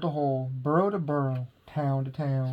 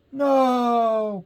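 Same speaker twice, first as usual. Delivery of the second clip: panicked cry; 8 semitones higher, 4 dB louder than usual. A narrator's reading. Crowd panics.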